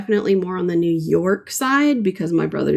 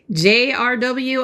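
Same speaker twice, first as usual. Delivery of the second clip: ordinary talk, nothing marked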